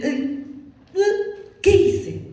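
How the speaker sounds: high startled what-did-I-do; retelling her own shocked cry; mock dismay. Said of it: "me acerqué y dije".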